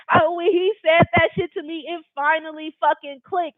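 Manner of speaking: normal talking voice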